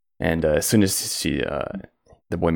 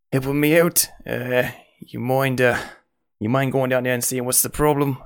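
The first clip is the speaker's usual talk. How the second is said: slightly higher, apologetic innkeeper; pleading innkeeper; 5 semitones higher than usual. Acted. Innkeeper to the adventurers, apologetic and pleading. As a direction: ask a favour sheepishly.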